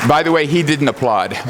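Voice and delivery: ordinary delivery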